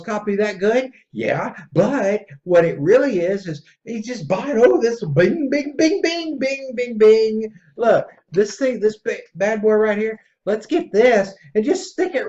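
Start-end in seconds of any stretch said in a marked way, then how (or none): 4.6-7.5 high sing-song bing bing; mock bing noises. Mocking buyers clicking purchase, high bing noises. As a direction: chime like a shopping trance. Mimicking impulse buying.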